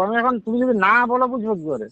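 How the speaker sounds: ordinary delivery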